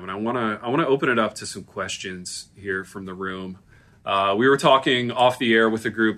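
low announcer voice; host it like a radio program. Acted.

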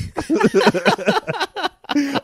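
loud burst of laughter; big laugh. Real, not acted. Laughs at own joke.

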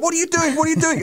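very high, alarmed cry; mock panic from the passenger seat; wife panicking as husband speeds; retold panicked wife. Wife panicking during the lesson.

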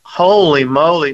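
slow it down like real astonishment; surprised exclamation. Real, not acted. Shocked at Dodgers blowout score.

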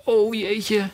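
high, sorrowful little "oh dear"; mildly distressed exclamation; dismayed. Acted. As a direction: let it out in sad dismay. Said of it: "riep Alice op bedroefde toon".

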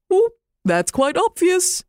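a lofty owl who finds it all obvious; wise owl. Acted. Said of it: "said the wise owl".

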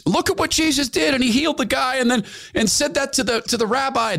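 excited followers. Retold or imitated. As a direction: retell it like thrilled disciples. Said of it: "and they're excited".

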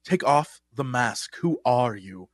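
low, slow, retold demand; mildly intense drama coach; intense acting teacher. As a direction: lightly imitate a solemn teacher probing a student. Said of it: "that was intense for a teacher".

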